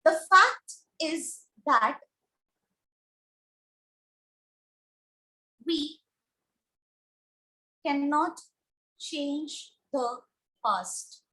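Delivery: word by word, with long pauses; slow dictation. Real, not acted. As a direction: dictate it slowly for a class writing it down. Dictating an example sentence while writing.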